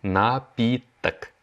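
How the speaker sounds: напиток drawn out slowly and clearly; slow repeat. Shown as a demonstration. Teacher models the word for students, slowly. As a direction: stretch напиток for learners. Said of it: "c'est напиток".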